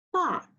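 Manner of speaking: "pa" falling sharply from high; fourth tone. Shown as a demonstration. Teacher drilling the fourth tone of "pa" for students. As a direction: snap "pa" straight down. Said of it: "Fourth tone".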